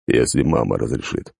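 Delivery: low, lifeless rasping voice; dead inhuman voice. Acted. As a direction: speak like something dead. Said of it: "мёртвого и нечеловеческого голоса".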